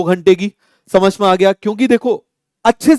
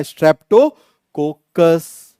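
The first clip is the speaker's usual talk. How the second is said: slow spelled-out term; much slower than usual. Shown as a demonstration. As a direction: stretch each syllable very slowly. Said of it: "क्या नाम हो जाएगा इसका?".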